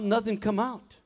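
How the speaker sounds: usual speaking voice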